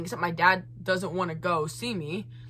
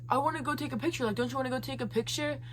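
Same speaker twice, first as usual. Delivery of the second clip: higher, softer imitation; lightly voiced wheedling request; Chad; 3 semitones higher, 8 dB quieter, much faster than usual. Chad asking for a red-carpet picture, softly imitated. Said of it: "Chad is like".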